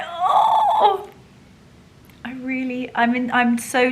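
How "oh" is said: high, loud torn cry; mock exasperated oh. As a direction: cry out, caught between two choices. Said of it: "and I'm like".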